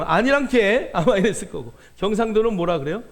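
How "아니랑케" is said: put-on playful Jeolla accent, a touch louder; 전라도 사투리로 장난스럽게; Jeolla dialect denial. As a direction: toss off a Jeolla-style no. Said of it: "전라도 사람은".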